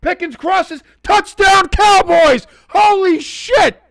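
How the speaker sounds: high, loud touchdown call; shouted play-by-play. Real, not acted. Streamer calling a Cowboys touchdown live, excited shout. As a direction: shout the touchdown in real shock.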